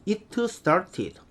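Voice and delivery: "it started" with an added Korean vowel, "i-teu seu-ta-ti-deu". Shown as a demonstration. Teacher mimicking extra-diligent readers, over-pronounced Korean-style English. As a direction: sound out every letter of "it started" as a separate Korean syllable. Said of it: "우리는".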